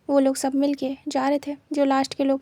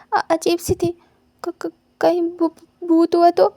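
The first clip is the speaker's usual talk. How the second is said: slow, stammering, then louder at the ghost; fearful stutter; 3 semitones higher, 6 dB louder, slower than usual. Acted. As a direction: dread building into a frightened cry. Scared student fears a ghost. (भूत के डर से हकलाते हुए)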